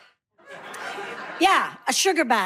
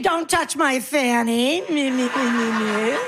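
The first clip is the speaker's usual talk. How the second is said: pretend deaf old lady. Comedian pretending deafness to the crowd, mock old-lady voice. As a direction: play deaf, answering the wrong words. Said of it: "I'll do what I do".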